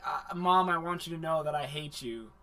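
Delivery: soft, fast, flat confession; understated cold son; flat cold declaration. Retold or imitated. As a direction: mumble the hate plainly. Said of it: "the guy is like".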